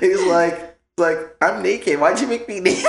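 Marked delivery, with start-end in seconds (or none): 1.4-2.8 put-on high-pitched little-boy complaint; imitated upset kid. Nephew protesting to uncle about his shirt, imitated upset kid. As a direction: mimic a small child protesting.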